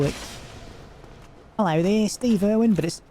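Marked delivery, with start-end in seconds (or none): 1.6-2.7 raised-pitch cartoon Aussie greeting.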